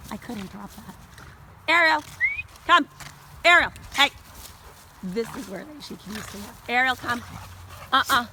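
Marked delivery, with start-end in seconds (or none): 1.7-4.1 high, loud name call; loud sharp hey to the dog. Real, not acted. Calling dog back. 6.7-8.3 loud recall and sharp no; louder stern uh-uh; firm call. Real, not acted. Correcting dog.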